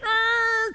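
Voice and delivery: high screech of a needle on vinyl; sustained vocal record scratch; record-scratch imitation. Shown as a demonstration.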